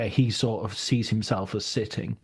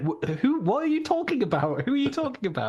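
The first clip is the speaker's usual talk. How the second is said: much higher, baffled, puzzled voice; baffled listeners; 12 semitones higher than usual. Retold or imitated. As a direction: play the confused friends who have no idea who he means. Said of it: "they'll be like".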